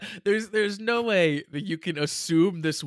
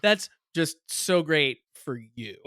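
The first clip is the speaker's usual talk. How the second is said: slow, drawn-out sarcastic praise; 3 dB louder, slower than usual. Shown as a demonstration. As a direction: stretch it out so the praise turns into a dig. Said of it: "you had to hear the intonation".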